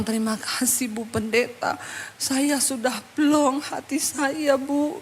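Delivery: soft, slowing, grateful voice; acted tearful relief, hushed; retold relieved thanks. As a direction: thank her softly, drained after crying. Relieved woman thanks pastor after crying.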